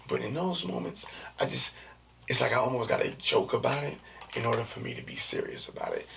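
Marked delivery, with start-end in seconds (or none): none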